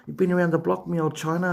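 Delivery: cheeky half-hearted Cockney; mock Cockney. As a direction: slip playfully into a hint of Cockney. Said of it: "I bet you know some good Cockney rhyme".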